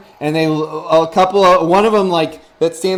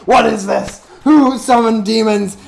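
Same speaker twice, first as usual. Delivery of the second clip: loud, high, indignant wizard voice; wizard's indignant challenge; 4 semitones higher, 5 dB louder than usual. Acted. Wizard to the demons, loud and indignant. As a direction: boom it out like a self-proclaimed master wizard. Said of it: "he raises his hands and he goes".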